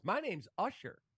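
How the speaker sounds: put-on high little-boy voice asking; kid's voice. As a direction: pitch it way up like a young kid. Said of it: "He goes".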